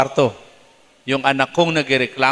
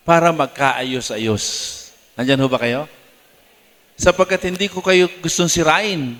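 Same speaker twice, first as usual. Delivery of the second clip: normal talking voice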